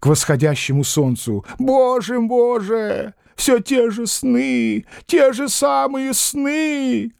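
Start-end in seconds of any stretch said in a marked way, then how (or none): 1.6-7.1 put-on high, slow, whining old man's plea; a plaintive, drawn-out high cry; tearful lament. A narrator's reading. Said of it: "старик заплакал".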